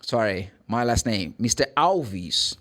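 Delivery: ordinary talk, nothing marked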